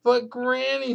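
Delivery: put-on high, small child's plea; a little boy protesting to granny; small boy pleading. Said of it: "said Patrick".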